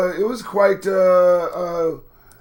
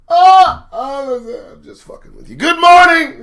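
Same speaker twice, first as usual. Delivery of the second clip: sudden loud, higher joking cry; playful loud burst; 10 semitones higher, 12 dB louder, slower than usual. Pretend. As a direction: burst out loud, then laugh it off. Mock scolding self in mirror, joking.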